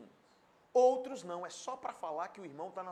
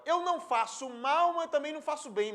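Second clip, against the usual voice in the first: mock quote; 8 semitones higher, 8 dB louder than usual. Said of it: "é aquela história".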